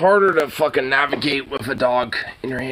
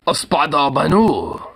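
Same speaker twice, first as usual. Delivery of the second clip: mock Japanese pronunciation; 5 dB louder, slower than usual. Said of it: "A Japanese Spider-Man?".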